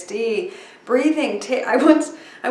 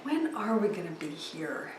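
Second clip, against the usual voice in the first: much softer, lower, musing on the question; soft reflective repeat; 4 semitones lower, 11 dB quieter than usual. Shown as a demonstration. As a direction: say it quietly like a small revelation. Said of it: "Daniel asked the right question".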